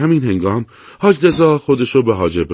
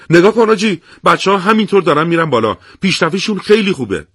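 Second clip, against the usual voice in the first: much higher, louder, delighted; happily; 8 semitones higher, 4 dB louder than usual. A narrator's reading. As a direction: excitedly point out the advance. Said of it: "با خوشحالی گفت". (با خوشحالی و هیجان، صدای بالاتر و بلندتر)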